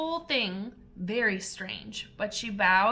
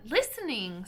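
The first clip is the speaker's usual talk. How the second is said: angrily; 4 semitones higher than usual. A narrator's reading. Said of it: "said the mouse to Alice angrily".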